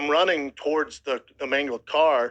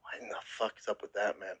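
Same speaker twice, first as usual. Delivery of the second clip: stunned mutter; 5 semitones lower, 12 dB quieter than usual. Retold or imitated. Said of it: "And I'm like".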